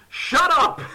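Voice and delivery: high, loud cut-off; angry retort. Retold or imitated. Ethan snapping back at Hannah, angry. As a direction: shut the complainer down loudly.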